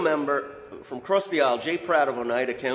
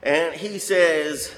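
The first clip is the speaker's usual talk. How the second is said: ordinary talk, nothing marked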